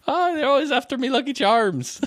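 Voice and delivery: high-pitched cartoon Irish accent; mock Irish leprechaun. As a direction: play a fake Irish cereal leprechaun. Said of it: "You're a leprechaun".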